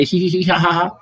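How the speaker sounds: high, put-on hee hee ha ha giggle; fake giggle. Mocking a woman's fake laugh on a date with a high giggle. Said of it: "Fake laughing and shit".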